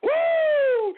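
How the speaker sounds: high, loud celebratory woo; whoop. Real, not acted. Host to listeners celebrating, a loud whoop. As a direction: let out a victory whoop.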